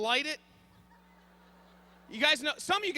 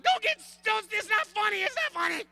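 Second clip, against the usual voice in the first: Terry's answer; 7 semitones higher than usual. Retold or imitated. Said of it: "then she says".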